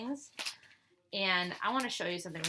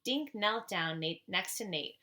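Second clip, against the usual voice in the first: usual speaking voice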